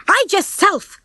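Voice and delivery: high-pitched, sharp retort; yelling back. A narrator's reading. Ginger rebuffs Rocky's plea, yelling back. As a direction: throw the demand back at them. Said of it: "Ginger yelled back".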